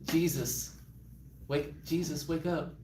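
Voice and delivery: soft, low, hesitant nudging; timid wake-up. Retold or imitated. Disciple gently waking the sleeping Jesus, soft and timid. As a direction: softly try to wake him. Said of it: "And he's like".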